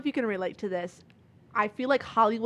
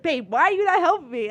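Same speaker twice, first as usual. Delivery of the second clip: mildly exasperated imitation, higher and louder; exasperated plea; 7 semitones higher, 7 dB louder than usual. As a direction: lightly mimic a partner nagging for help. Retells boyfriend's impatient plea at checkout.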